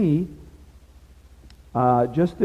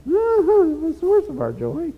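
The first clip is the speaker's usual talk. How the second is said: much higher, slow mock-pompous preaching; hammed-up high preacher sing-song; slower than usual. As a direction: parody your own rusty sermon delivery. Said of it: "can you tell I haven't talked or preached for a while?".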